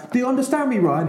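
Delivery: high, hammed-up Irish accent of an old coach; Declan Kidney's Irish accent. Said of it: "The accent's kind of important".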